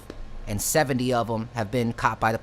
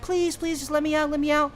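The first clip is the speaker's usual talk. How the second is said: desperate begging; 13 semitones higher than usual. Retold or imitated. Kid begging to be freed.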